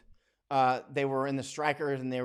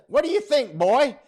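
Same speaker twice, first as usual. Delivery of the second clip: much higher and louder, teasing; teasing Viola; 12 semitones higher, 12 dB louder than usual. Retold or imitated. Viola teasing the boy about the pipe, loud. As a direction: playfully scold the boy. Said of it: "And she says".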